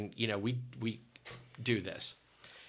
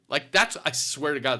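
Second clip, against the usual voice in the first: normal talking voice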